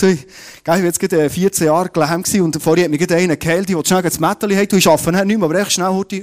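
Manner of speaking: fast, loud, hurried apology; flustered excuse. Acted. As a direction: blurt out excuses in a rush, acting it. Healed man excuses himself to Pharisees. (gespielt hastige, aufgeregte Ausrede)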